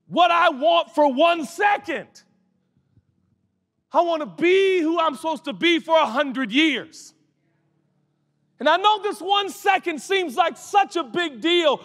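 preaching at full force. Acted. Preacher shouting to the congregation about the soul. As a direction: drive the point home to the congregation.

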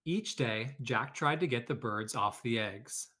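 lively read-aloud storytelling voice; story narration. A narrator's reading. Teacher reading a picture book to her class, lively storytelling.

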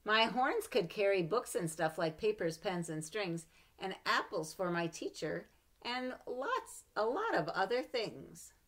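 put-on lively read-aloud for children; story reading. A narrator's reading. Mother reading a picture book to her child, lively.

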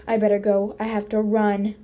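ordinary delivery, nothing marked

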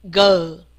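g as a low, loud, drawn-out "guh"; 发音示范. Shown as a demonstration. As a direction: let g sink slowly with its vowel. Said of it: "我注意听一下". (低而响地拉长念g)

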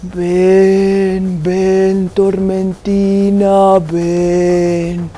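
low, slow ghost calling; low, slow eerie calling voice; ghostly voice. Acted. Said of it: "una voz que me decía".

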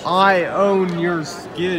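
drawn-out slow declaration; slow mock claim. Joking about touching Trump, slow mock claim. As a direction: stretch each word like a proud boast. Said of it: "echo of the joke".

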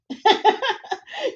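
high teasing laugh; mocking laugh. Brothers laugh at Sydney.

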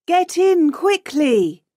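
high urgent warning; urgent shout. A narrator's reading. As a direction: call out high, at moderate volume. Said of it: "Tonya shouts".

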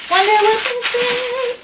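high, drawn-out singsong; playful high-pitched curiosity; singsong wondering. Real, not acted. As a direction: wonder aloud in a high, slow lilt. Curious singsong while opening parcel.

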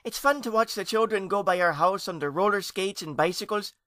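put-on high, loud eager child; youngest ghost child. A narrator's reading. Youngest nephew watching children at play, eager. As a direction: chatter up high with delight.

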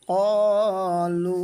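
"কলু" loud, with very long vowels; slow chanted "কলু", long "uu" at the end; three-alif elongation. Shown as a demonstration. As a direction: hold "ক" and "লু" on a level note. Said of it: "তাহলে এভাবে বলবো".

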